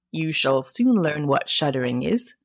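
low-key, inward aside; private scheming thought. A narrator's reading. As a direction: think it to yourself, understated. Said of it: "thought he".

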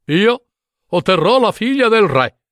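self-assured suitor's proclamation; boastful declaration. Acted. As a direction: announce it grandly, sure of winning. Brothers boast of winning the princess.

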